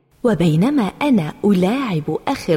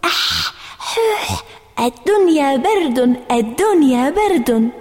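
mild mocking; 4 semitones higher than usual. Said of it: "صار سمير يضحك أكثر ويقول".

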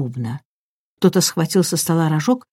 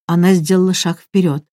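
usual speaking voice

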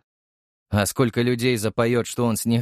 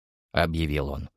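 ordinary delivery, nothing marked

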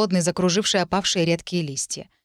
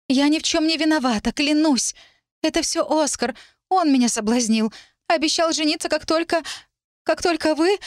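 высокий жалобный голос красавицы; оправдывайся умоляюще, высоко, обрывая фразу в конце; слёзные оправдания; 9 semitones higher than usual. A narrator's reading. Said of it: "Она всхлипнула". (плаксиво и высоко, клянётся в невиновности)

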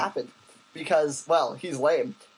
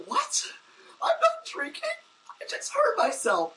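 much higher, squeaky and offended, half-breathed; indignant squeak. Retold or imitated. Injured cyclist answers a jeering driver, squeaky indignation. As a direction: squeal back in hurt disbelief.

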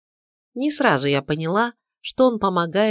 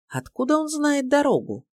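surprised; 10 semitones higher than usual. A narrator's reading. Narrator's surprised inner question.

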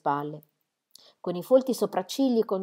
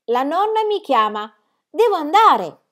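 high, loud insistence; urgent child's protest; 8 semitones higher, 7 dB louder than usual. A narrator's reading. Child tugging back toward grandmother, urgently insisting. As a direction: insist you must go, pitched up.

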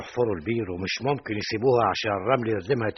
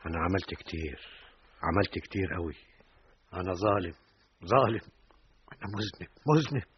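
guilty despair; 4 semitones lower, 5 dB quieter than usual. Acted. Father confessing guilt.

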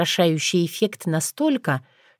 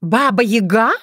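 loud, higher, startled cry of the name; surprised exclamation; 4 semitones higher, 4 dB louder than usual. Acted. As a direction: blurt it out in shock. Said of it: "воскликнула Горислава".

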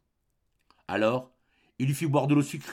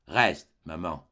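low, slow and soft; 7 semitones lower, much slower than usual. A narrator's reading. Son soothing his frightened mother, soft and slow. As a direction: gently ask mother to stay. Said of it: "d'une voix douce".

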